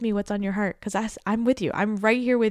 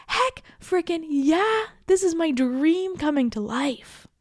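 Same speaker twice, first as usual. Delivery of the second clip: much higher, slow, stretched-out joyful cheer; excited cheer; 9 semitones higher, slower than usual. Pretend. Host acting out answering the door to her dream, thrilled. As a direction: shout it like your dream just walked in. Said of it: "we can be like".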